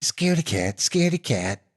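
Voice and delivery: high sing-song teasing chant; mocking taunt. A narrator's reading. Little brother mocking Shaky, sing-song taunt. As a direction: taunt him like a bratty kid. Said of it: "his little brother mocked him".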